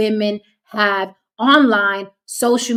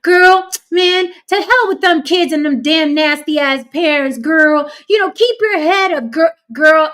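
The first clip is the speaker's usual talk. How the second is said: higher, louder mock-supportive girlfriend; hammed-up comment-section sympathy; gushing sympathetic commenters; 6 semitones higher, 5 dB louder than usual. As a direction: hype her up like an indignant online friend. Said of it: "She wants people to be in the comments".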